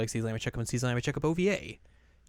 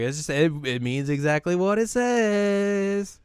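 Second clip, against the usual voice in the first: coy voice; 7 semitones higher, 4 dB louder, slower than usual. Retold or imitated. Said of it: "And Mari's like".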